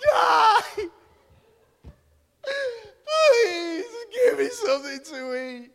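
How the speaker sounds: high, loud, hammed-up crying shout; hungry child's wail. Son begging parents for food, hammed-up crying wail. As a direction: wail it like a starving toddler. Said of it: "his response was".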